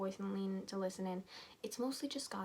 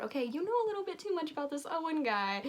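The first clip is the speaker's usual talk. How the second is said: teasing; 7 semitones higher than usual. Real, not acted. Teasing the story's narrator.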